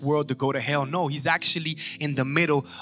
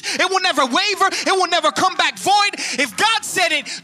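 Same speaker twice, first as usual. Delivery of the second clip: high, loud, rising sermon cadence; fiery pulpit preaching; preaching at full force; 11 semitones higher, 6 dB louder than usual. Acted. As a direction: drive each line home to the congregation.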